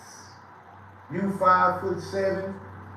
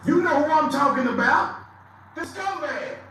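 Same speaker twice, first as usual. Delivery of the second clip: louder and higher, rising at the end; loud call-out; 8 semitones higher, 6 dB louder than usual. Real, not acted. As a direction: shout it at the rival. Calling out rival on stream.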